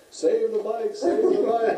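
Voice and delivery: high, loud mock rallying cry; imitated crowd yelling. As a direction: holler like the tavern crowd. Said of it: "and they're yelling".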